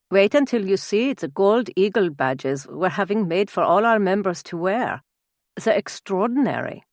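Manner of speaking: put-on proud German-accented boast; fiery Germanic Baron. Baron shows off his society to guests, proud Germanic accent.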